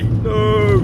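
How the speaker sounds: high, loud mock protest; a playful, dramatic groan of no; mock dismay. Real, not acted. Groans at going back to work.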